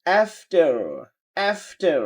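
American "after" with AE and final R; American accent. Shown as a demonstration. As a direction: drill "after" American style, AF-ter. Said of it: "American বলছে". (American উচ্চারণে)

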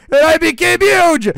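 loud, high thrill; excited. Acted. Goku bird thrilled at his giant size, loud excited shout. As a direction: shout with joy at the change.

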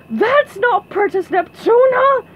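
high, loud, slow denouncing shout. A narrator's reading. Guy in the crowd exposing the fake, very loud. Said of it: "said a guy with a very loud voice".

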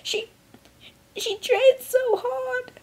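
high, drawn-out helpless wail; acted high-pitched weepy lament; helpless sobbing. A narrator's reading. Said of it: "helplessly sobbed".

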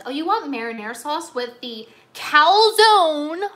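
slow, loud, mocking cashier voice. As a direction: drag it out like a stingy cashier. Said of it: "They're like".

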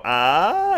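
high mock yelp; mock death cry. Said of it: "Did they really go".

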